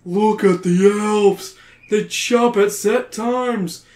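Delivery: high, slow urgent shout; cries out. Acted. Brad crying out his plan to friends. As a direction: point out the elves' timing eagerly. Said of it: "Brad cries out".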